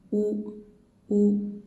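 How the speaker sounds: short rounded "U". Shown as a demonstration. Teacher demonstrating short vowel u. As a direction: round the lips on u. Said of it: "first one is vowel".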